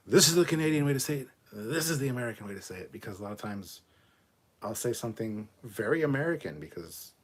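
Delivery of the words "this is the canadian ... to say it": loud, higher, fast mock proclamation; mock declaration. Streamer mimics people insisting on one pronunciation, mock declaration. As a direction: rattle it out emphatically as if laying down a rule. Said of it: "But you can't say".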